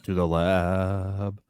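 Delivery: very slow, soft and ominous; slow ominous echo. Acted. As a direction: drag it out like a spooky tease.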